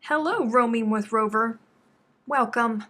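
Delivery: loud, bright hello; loud excited hello; excited greeting. Real, not acted. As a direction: call out a big welcome. Greeting viewer by name.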